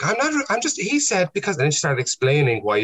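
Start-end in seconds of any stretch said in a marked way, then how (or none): 0.0-1.6 high-pitched mimicked protest; imitating flustered heckler. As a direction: play her explaining herself in a high voice. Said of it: "And then she was like".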